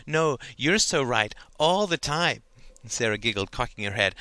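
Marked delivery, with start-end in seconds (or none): 0.1-2.4 slow, mocking put-on voice, louder; fake voice. Girl teasing her best friend in a fake voice. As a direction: ham up a sugary agreeable friend. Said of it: "put on a fake voice".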